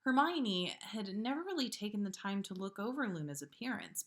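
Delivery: usual speaking voice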